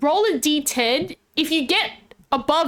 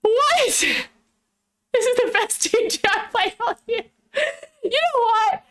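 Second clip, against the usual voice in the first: laughing; 7 semitones higher than usual. Real, not acted. Cracking up at the game.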